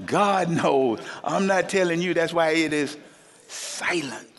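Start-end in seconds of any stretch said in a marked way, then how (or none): none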